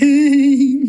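drawn-out giddy greeting dissolving into giggles; hammed-up giggly hello. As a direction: play the giggly, embarrassing mom. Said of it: "I get giddy".

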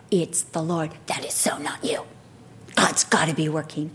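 low, breath-only undertone; hushed amazement. Retold or imitated. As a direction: whisper it like an amazed onlooker. Voices onlookers' hushed amazement.

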